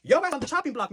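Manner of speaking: high, loud outraged clapback; loud, high exasperated roast of the commenter; outraged call-out. Real, not acted. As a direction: let her have it, scandalised. Clapping back at a commenter.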